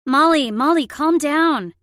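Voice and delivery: loud, high, urgent command; instructing. A narrator's reading. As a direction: call out firmly to calm someone who is upset. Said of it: "she instructed".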